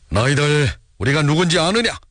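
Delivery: high-pitched, forceful character cry; commanding shout. A narrator's reading. Han Dong-jeong reveals they are the Red Army, shouting. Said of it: "한동정이 소리를 쳤다".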